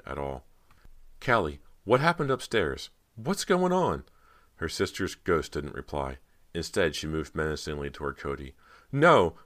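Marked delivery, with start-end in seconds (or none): 1.2-4.1 high, loud, urgent questions; alarmed questioning. A narrator's reading. Frightened girl questions her ghost sister. 8.9-9.4 high, loud cry of terror; terrified shriek. A narrator's reading. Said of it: "Cody shrieked, feeling terror in her chest".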